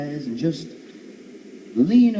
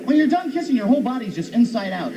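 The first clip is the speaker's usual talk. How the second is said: normal talking voice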